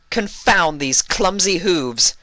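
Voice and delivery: high, slow, frustrated pony princess; high exasperated Luna outburst; young Luna exclaiming. Acted. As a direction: huff it out like a cross little princess. Luna character voice.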